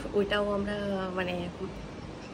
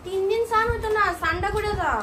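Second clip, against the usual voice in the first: much higher, loud nagging; high scolding call; 11 semitones higher, 5 dB louder than usual. Real, not acted. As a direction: nag him to get washing. Scolding brother to bathe.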